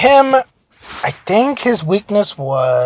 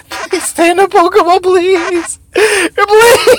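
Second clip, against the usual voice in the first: desperate pleading; 13 semitones higher, much slower than usual. Real, not acted. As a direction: beg it in a high squeal. Begging Pokemon to stay caught.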